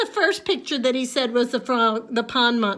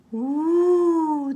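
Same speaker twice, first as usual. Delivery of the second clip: wind howl; 3 semitones higher, 4 dB louder than usual. Acted. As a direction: moan it like wind in the trees. Wind sound in the woods.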